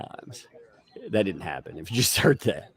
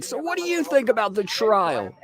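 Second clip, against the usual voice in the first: mock reporter; 11 semitones higher than usual. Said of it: "I'd be like".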